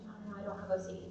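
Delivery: rapid, hushed brush-off; quick denial. Retold or imitated. Retelling to the audience how she waved off doctors, quick and hushed. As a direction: wave off the doctor's diagnosis fast and low. Denying the OCD diagnosis.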